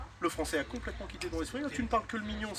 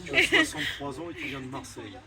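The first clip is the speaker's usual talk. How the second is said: laughing; 8 semitones higher, slower than usual. Real, not acted. Cracks up mid suggestion.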